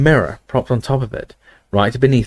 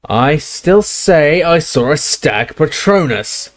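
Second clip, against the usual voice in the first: higher, slow stubborn shout; 6 semitones higher, slower than usual. Acted. Death Eater shouting his claim at the barman, higher. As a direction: insist it, raising the voice. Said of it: "shouted the first Death Eater".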